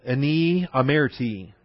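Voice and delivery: "Ani amerti" read slowly, ah-NEE ah-MER-tee; Hebrew reading. Shown as a demonstration. Teacher reading the Hebrew aloud slowly. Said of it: "The, uh, Hebrew".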